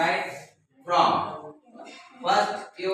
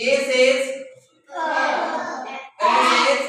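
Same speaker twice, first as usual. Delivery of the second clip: leading repeat-after-me; 5 semitones higher, slower than usual. Shown as a demonstration. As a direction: call out the phonics prompt for the class to echo. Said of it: "after me".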